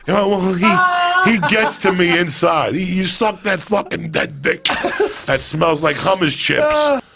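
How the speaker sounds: high, slow, hammed-up creep voice; mock sleazy guru voice. Comic telling Bikram's lines in a high hammed-up voice.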